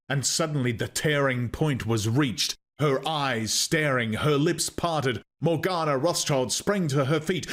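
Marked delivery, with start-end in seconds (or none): none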